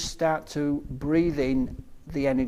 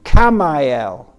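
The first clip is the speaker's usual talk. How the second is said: solemn invocation; 2 semitones higher, 9 dB louder than usual. Real, not acted. Meditation leader calling archangels to help the group, solemn invocation.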